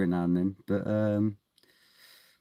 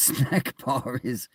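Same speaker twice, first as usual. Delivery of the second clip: ordinary talk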